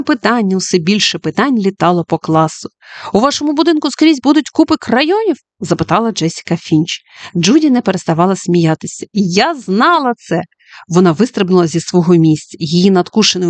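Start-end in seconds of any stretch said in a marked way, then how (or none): 3.1-5.4 put-on high eager little girl voice; curious child. Jessica asking the teacher, high eager child voice. 9.1-10.4 slow, gleeful i-knew-it; triumphant glee. Acted. Judy jumping from her seat, gleeful triumph.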